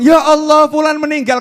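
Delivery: loud, high cry of shock; loud exclamation. Retold or imitated. People crying out when they hear you have died, loud shock. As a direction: cry out at the news of a death.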